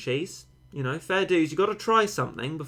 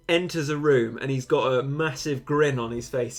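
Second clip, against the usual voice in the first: ordinary talk, nothing marked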